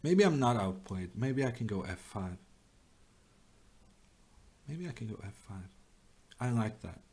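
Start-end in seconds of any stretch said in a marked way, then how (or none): 4.7-5.7 fast, much softer repeat to himself; quick quiet mutter. Real, not acted. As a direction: mutter the move under your breath, quickly.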